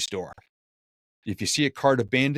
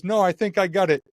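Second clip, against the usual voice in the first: confident stranger; 9 semitones higher, 5 dB louder than usual. Retold or imitated. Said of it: "he goes".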